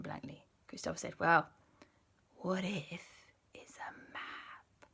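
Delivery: soft unvoiced whispering; whispered. The two boys whisper to each other about the hidden paper. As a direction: hush it so nobody hears.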